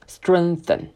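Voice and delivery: "Strengthen" with tongue between the teeth, pushed back; slow model "Strengthen", th bitten; th demo. Shown as a demonstration. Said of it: "Try to follow with me". (跟读示范"Strengthen"，咬舌)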